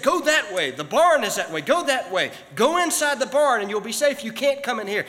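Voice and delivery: loud, high calls to steer the bird away; shouting at the bird. Retold or imitated. Man shouts at bird outside his window, loud and high. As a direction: yell directions through the window.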